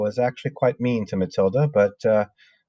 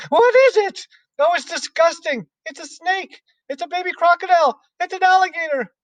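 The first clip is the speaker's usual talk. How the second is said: put-on much higher squealing kids' voices; high excited classroom squeals; shouting children; 4 dB louder than usual. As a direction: shriek like frightened schoolchildren.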